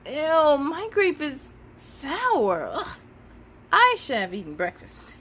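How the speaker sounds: put-on a touch of a whiny kid; lightly voiced complaining boy; mild whine. Leo grumbling to his teacher about sour grapes, mildly whiny. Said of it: "Leo whined".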